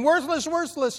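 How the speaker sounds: high, half-hearted yell at the drill; mock scolding. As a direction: scold the drill again, lighter. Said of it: "I'm saying".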